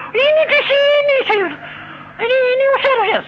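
high, loud frantic scream with a laugh. Retold or imitated. Wife screams in panic at the demon. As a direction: shriek in panic, pointing at it. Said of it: "Istri saya teriak-teriak".

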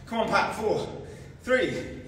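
usual speaking voice

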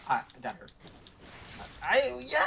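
ordinary talk

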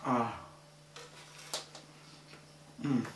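ordinary talk

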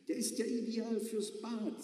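wife's voice. Acted. Wife voiced in the story.

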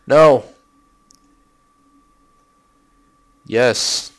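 loud, drawn-out mock Kennedy voice; Robert Kennedy impression. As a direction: belt it out as a hammed-up Kennedy. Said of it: "That was my Robert Kennedy impersonation".

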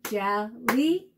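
"Jellyfish" split into three separate beats; syllable by syllable. Shown as a demonstration. Teacher repeating the count for kids, "Jellyfish" broken syllable by syllable. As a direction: clap out "Jellyfish" with a gap after each syllable. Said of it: "fun to clap out the syllables".

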